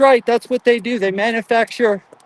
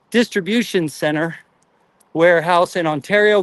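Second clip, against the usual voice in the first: ordinary talk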